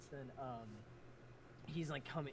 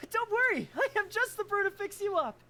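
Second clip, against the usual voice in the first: bright, high, upbeat healer; cheerful high character voice; 15 dB louder than usual. Acted. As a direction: reassure them brightly, way up high.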